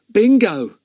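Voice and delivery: Ern, high and proud; beaming with pride in his dog. A narrator's reading. Ern naming his dog to the super, proudly. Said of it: "with such pride in his voice".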